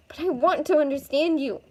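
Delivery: high, insistent pleading; earnest plea. Acted. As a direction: plead urgently to be let in. Girl pleads with rescuer.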